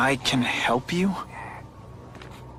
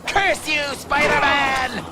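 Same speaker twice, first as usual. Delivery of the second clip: hammed-up cartoon villain, drawn out and higher; mock villain curse; 10 semitones higher, slower than usual. Spider-Man teasing Craven, playing a mock cartoon villain. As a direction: ham it up like a comic-book baddie shaking his fist. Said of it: "say some fun bad guy things like".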